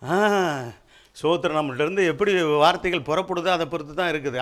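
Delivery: usual speaking voice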